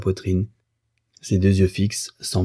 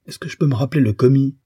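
quick, higher defensive question; irritated protest; 6 semitones higher, faster than usual. A narrator's reading. Husband questioned about the clerk, irritated and defensive. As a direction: rush it out in mild exasperation.

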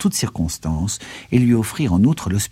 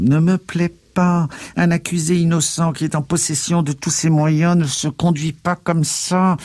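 uncle's voice raised high in disapproval; agitated scolding; 9 semitones higher than usual. A narrator's reading. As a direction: lecture him on a high, stretched pitch. Uncle scolds his nephew.

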